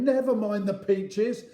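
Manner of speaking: high, loud, harassed retort; shouting back. Acted. Dad hanging on, answering Joe, harassed shouting. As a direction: yell it back impatiently.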